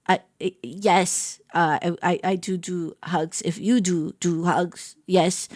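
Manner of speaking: slow, low, quiet nervous stammer; flustered stammer. Retold or imitated. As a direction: stumble over the words, starstruck. Flustered answer to hug request.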